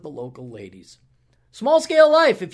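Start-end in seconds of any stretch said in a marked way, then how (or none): 1.5-2.4 announcer shout. Acted. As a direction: belt the show name out high and loud. Plugging a show name loudly.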